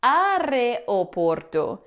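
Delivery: areoporto slowly as a-re-o-POR-to; common mispronunciation. Shown as a demonstration. Teacher showing another mistake, areoporto slowly as a-re-o-POR-to. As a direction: spell out a-re-o in areoporto. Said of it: "or you might hear".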